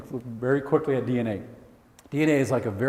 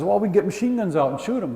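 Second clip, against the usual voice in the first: eager dinosaur; 7 semitones higher, 3 dB louder than usual. Pretend. Second dinosaur suggests.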